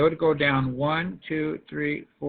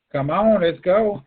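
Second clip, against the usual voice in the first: urging call; 3 semitones higher, 7 dB louder, slower than usual. Real, not acted. Teacher urging silent class.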